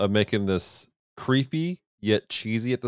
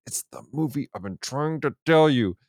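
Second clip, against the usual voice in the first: higher, strained excited insistence; freaking out; 4 semitones higher than usual. Retold or imitated. Telling doubting friends the movie is real, freaking out. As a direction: act out exasperated vindication to friends. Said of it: "I remember freaking out".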